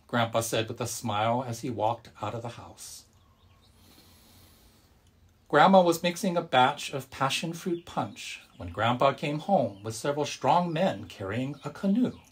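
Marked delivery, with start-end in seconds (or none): none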